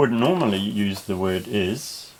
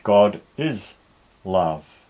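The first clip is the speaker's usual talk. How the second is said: "God is" punched loud, "love" falling; emphatic reading; 5 dB louder, slower than usual. Shown as a demonstration. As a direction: lean hard on "God is", then let "love" drop. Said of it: "we might put a bit of emphasis on it".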